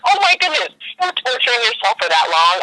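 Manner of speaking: high, incredulous outburst; shocked. Retold or imitated. Retelling her shocked reply to mom, high disbelief. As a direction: squeal it in disbelief.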